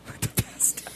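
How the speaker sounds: breathy whispered movie line; whispering the line back; whispered quote. Retold or imitated. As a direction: hush the quote out.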